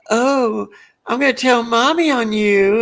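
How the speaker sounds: high, put-on tattletale voice; Larry impression. Larry threatening to tell on Moe, imitated in a high voice. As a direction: whine it high like a tattling child. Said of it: "And Larry goes".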